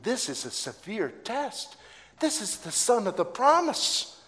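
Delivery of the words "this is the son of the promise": normal talking voice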